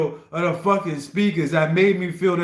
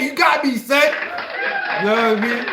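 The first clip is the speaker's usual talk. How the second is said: excited; 5 semitones higher than usual. Real, not acted. Delighted the track fooled him.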